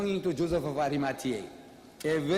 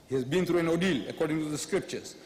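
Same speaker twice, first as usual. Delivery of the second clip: ordinary delivery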